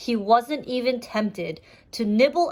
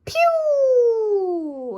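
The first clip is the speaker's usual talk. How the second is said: pew sound effect; 12 semitones higher, 5 dB louder than usual. Acted.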